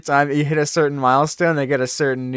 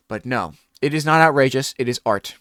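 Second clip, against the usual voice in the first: ordinary talk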